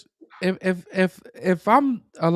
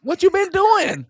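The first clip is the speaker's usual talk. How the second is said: a much higher, slower mock voice; mock demand; 10 semitones higher, 3 dB louder than usual. Cohost mocking the mayor's delay, high mock demand. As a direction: jeer it like a put-upon voter.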